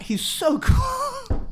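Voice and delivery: very high, drawn-out swooning wail; fan squeal. Real, not acted. Podcaster gushing to co-hosts about Chris Pratt, high swooning squeal. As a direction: squeal it slowly like an overwhelmed fan. Fangirling over the lead actor.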